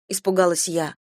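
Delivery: ordinary delivery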